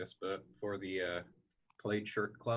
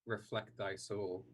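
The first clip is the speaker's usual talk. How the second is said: solemn mock stage verse; Shakespearean; 3 semitones lower, 8 dB quieter than usual. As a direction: recite gravely like a Shakespeare player.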